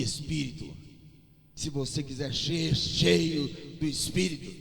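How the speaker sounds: slow, swelling pulpit crescendo; rising preaching. Real, not acted. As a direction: climb to a fervent preaching peak.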